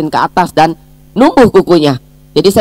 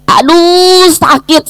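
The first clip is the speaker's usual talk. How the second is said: high-pitched, drawn-out wail of "ow"; re-enacted cry of pain; 14 semitones higher, 3 dB louder, much slower than usual. Mother alone at home after jamming her finger, high pained cry. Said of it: "Saya baru teriak".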